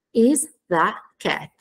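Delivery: slow, separated words with falling ends. Shown as a demonstration. Teacher showing how word order flips, word by word. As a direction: set IS, THE and CAT apart. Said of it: "viene girato in un semaforo inverso".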